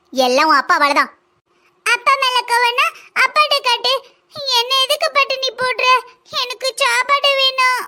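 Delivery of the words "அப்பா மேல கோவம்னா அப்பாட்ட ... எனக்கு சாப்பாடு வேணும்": put-on high, whining child voice; higher-pitched little child grumbling for food; hungry child. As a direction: complain to mum like a hungry kid. Hungry child protests to mother.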